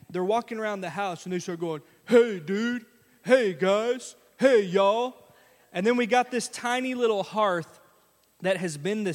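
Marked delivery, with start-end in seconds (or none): 2.0-5.2 put-on kids aping their father's loud hello; a child hamming up daddy's greeting; imitating daddy. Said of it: "pretend to be daddy".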